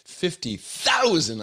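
loud, high hype announcer; monster truck ad. Pretend. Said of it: "I have to say that like a monster truck announcer".

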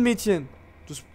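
ordinary talk, nothing marked

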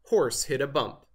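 usual speaking voice

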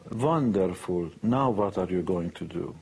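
put-on slow, drawn-out wonderful with a V for the W in a light Hungarian accent; his mother, unimpressed, in a lightly imitated Hungarian accent. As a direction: drag out the praise like his mother would. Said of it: "in her broken English".

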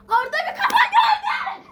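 high, loud playful scared cry with a laugh; scared shout. Pretend. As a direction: squeal it out in mock fright in the dark. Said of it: "ben karanlıkta korkuyorum".

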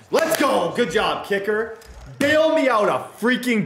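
sudden high cheer for the kick; excited cheer. Real, not acted. Gamer cheering his kicker, excited shout. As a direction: yell it out, pumped. Big kick made during game.

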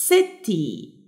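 "city" drawn out as si, tee; slow "city", each syllable held; split into syllables. Shown as a demonstration. Teacher showing two syllables, "city" syllable by syllable. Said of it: "عشان كده دي two syllables".

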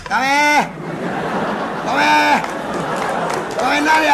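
the fool announcing himself far too loudly at the house of mourning; booming call at the door. Acted. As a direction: bellow it like a town crier. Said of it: "なんじゃ、休館長みたいな声出して。".

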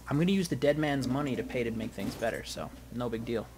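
ordinary delivery

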